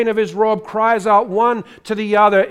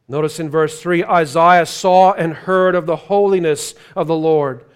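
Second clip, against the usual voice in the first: ordinary talk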